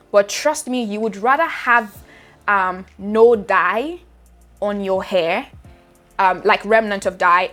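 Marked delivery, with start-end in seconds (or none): none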